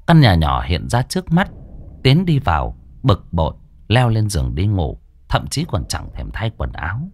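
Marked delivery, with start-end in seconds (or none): none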